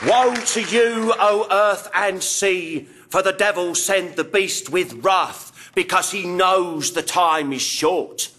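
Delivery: slow, portentous preaching; solemn scripture recital. Acted. Archbishop opening his act with doom-laden scripture, slow and solemn. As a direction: intone it gravely, falling in pitch.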